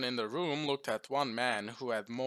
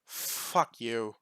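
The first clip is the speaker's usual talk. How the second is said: short burst of defiance; spat out defiantly; 4 dB louder than usual. A narrator's reading. Bloodied general to his captor, spitting defiance. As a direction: spit the words at your captor. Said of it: "he managed to spit out".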